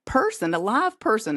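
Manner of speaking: usual speaking voice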